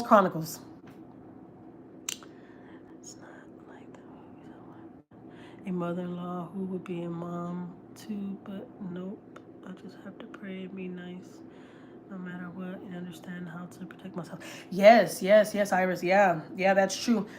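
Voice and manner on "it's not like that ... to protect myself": softer, slow hushed aside; real whispered confession. Real, not acted. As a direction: drop to a whisper as if confiding.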